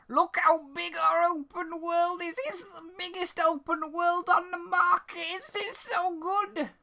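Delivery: mildly mocking trailer hype; mock hype pitch. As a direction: sell it like a fake trailer voice. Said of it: "And man".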